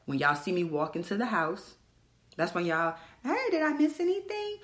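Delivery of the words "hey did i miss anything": much higher sing-out greeting; mock-casual high entrance; breezy feigned innocence. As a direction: pop in high, as if nothing happened. Replays her entrance, feigning innocence.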